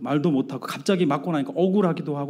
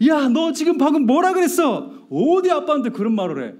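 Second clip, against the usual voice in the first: high, loud acted scolding; angry outburst; 12 semitones higher, 4 dB louder than usual. Dad to son who talked back, angry outburst. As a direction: blow the lid off, re-enacting an angry dad. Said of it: "뚜껑이 이제는 확 열리는 거예요".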